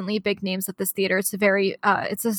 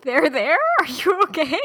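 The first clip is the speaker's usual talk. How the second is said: higher, a hint of a fretting bystander; mock worried friend; 7 semitones higher than usual. Friend mimics Mike patting a crying woman, mock worried. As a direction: lightly play the worried friend checking in.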